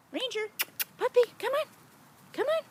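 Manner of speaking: very high coaxing call. Real, not acted. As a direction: sing the pup over to you. Calling puppy.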